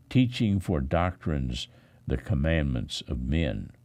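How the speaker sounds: usual speaking voice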